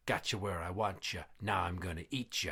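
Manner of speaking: low, hushed ghostly threat; quiet menacing sing-out from the dark; spooky voice. Acted. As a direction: drop it low and soft, like a voice haunting a room. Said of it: "And then he hears".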